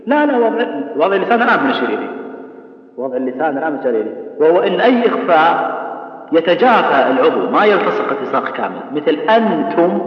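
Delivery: normal talking voice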